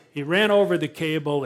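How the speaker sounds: ordinary talk, nothing marked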